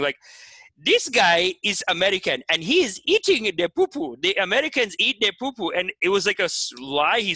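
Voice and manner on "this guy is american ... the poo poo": mock Nigerian accent, emphatic preaching; Nigerian preacher. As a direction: imitate a Nigerian pastor teaching his church. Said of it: "this guy in Nigeria, he starts doing".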